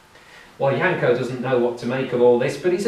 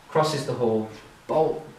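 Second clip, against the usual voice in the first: ordinary delivery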